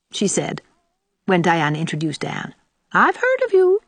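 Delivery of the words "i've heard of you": high, loud, pointed disapproval; disapproving. A narrator's reading. Old aunt to newly introduced Anne, pointedly disapproving. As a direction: let it imply you heard nothing good. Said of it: "Her tone implied that she had heard nothing good".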